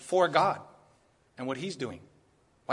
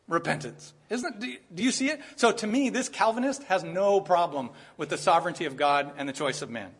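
normal talking voice

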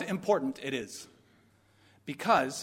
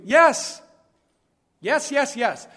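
loud, high burst, easing on the repeats; emphatic yes; 6 semitones higher, 8 dB louder, much slower than usual. Real, not acted. Preacher answering his own doubting question, emphatic. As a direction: hammer the answer home.

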